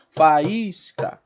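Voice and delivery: "Faísca" stretched into fa-ÍS-ca; syllable separation. Shown as a demonstration. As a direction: give each syllable of "Faísca" its own beat. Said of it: "separação dos sons, né, em cada sílaba". ("Faísca" sílaba por sílaba)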